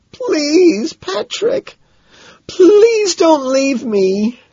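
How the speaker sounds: high-pitched, drawn-out tearful plea; crying out. A narrator's reading. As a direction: beg in a high, wailing cry. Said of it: "cries out".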